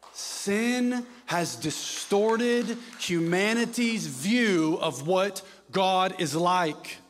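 loud, slow, drawn-out pulpit declaration; full-force preacher's proclamation; emphatic preaching. Acted. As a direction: lean hard into every word from the pulpit.

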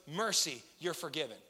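soft, low, tender pardon; gentle forgiveness. Retold or imitated. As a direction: softly grant forgiveness. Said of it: "he goes".

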